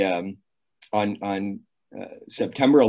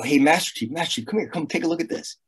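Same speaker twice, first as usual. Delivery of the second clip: higher-pitched retold call for attention; calling someone over; 6 semitones higher, faster than usual. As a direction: lightly re-enact beckoning a colleague over. Retold call to his senior colleague.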